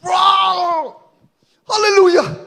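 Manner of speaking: high, loud cry with a sob; preacher overcome with joy; tearful joyful cry. Real, not acted. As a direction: break into teary praise. Overcome recounting victory.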